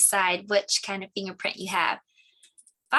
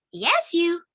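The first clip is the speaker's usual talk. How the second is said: higher, louder bird accusation; accusing bird; 8 semitones higher, 6 dB louder than usual. Acted. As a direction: point the finger as the bird.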